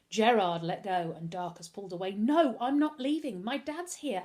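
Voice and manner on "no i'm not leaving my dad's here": high, insistent refusal; defiant boy, pitched high and loud; loud protest. A narrator's reading. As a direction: protest urgently, voice raised. Boy refuses to leave his father.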